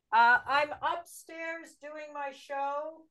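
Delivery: calling out loudly to someone downstairs; yelling across the room. Real, not acted. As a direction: raise your voice to reach another room.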